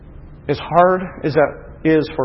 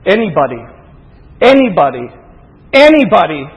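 rising preacher's repeated call; building repetition; 3 semitones higher, 8 dB louder than usual. Real, not acted. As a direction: build from plain call to a ringing cry.

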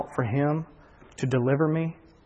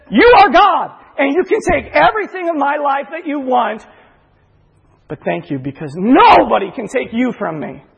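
much higher, much louder prayer of thanks; shouted praise; 9 semitones higher, 7 dB louder than usual. Retold or imitated. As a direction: shout thanks to God with full fervour. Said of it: "praising God and thanking God".

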